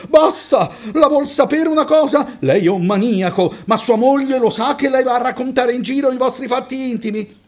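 indignant passenger shouting; outraged shout. A narrator's reading. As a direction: cut him off in disgust. Claudia explodes at the driver.

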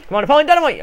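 excited cheer. Real, not acted. Player urging on his batter, excited cheer. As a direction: urge the batter on with a yell.

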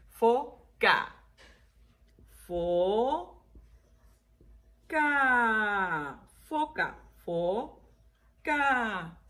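"Foca" split into fo, ca, syllables held. Shown as a demonstration. Teacher counting two syllables while writing, "Foca" slowly split. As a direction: pause between long fo and ca in "Foca". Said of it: "dos sílabas otra vez".

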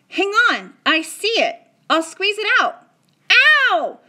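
loud, high and hurried; busy fixing a wound; boy rushing to pull out the tiny bullet; urgent. A narrator's reading. Omri squeezes out the bullet.

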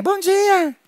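very high, slow sing-song morning greeting; high drawn-out cartoon spider voice; waking spider. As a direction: stretch it out like a sleepy little spider.

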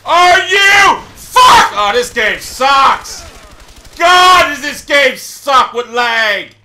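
loud, high bursts of gamer rage; enraged shouting. Real, not acted. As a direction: explode at the laggy game. Player enraged by game lag.

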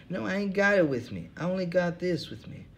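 a weary bum who cares for nothing, high; second hobo voice. Acted. Bum Hubbard answering the interviewer, in a high tired voice.

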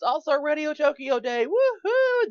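high, slow build to a whooping cheer; excited cheer. Real, not acted. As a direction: hype up the show day and whoop. Hyping show day.

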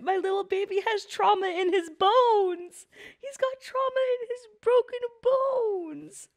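a touch of teary, high, slow wailing over a hurt character; mock distraught. Real, not acted. Grieving over anime character.

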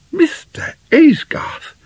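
very high, slow, gasped exclamation; shocked gasp. A narrator's reading. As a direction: gasp the name in indignant astonishment. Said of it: "she gasped".

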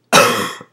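harsh chesty cough; real sick cough; loud cough. Real, not acted. Sick with pneumonia.

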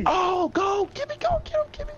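high, frantic cheers; frantic cheering. Real, not acted. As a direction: egg him on at the top of the voice. Frantic cheering during chase.

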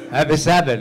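normal talking voice

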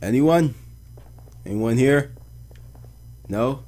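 calling out. Real, not acted. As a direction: call out to see if anybody is there. Calling into an empty place.

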